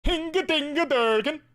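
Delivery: high, sing-out cartoon holler; silly catchphrase cry. Mock SpongeBob catchphrase outburst.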